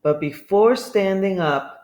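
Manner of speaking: ordinary delivery, nothing marked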